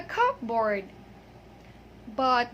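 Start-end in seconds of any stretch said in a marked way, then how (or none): 0.0-0.8 "cupboard" as two words, cup and board; "cupboard" said just as it is spelled, CUP-BOARD; spelling pronunciation. Shown as a demonstration. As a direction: sound both the p and b in cupboard. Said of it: "it looks like a cupboard".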